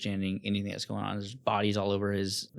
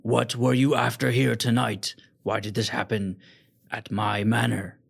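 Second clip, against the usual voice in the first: loud, hard questions; demanding lord; 7 dB louder than usual. Acted. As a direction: push them for the truth.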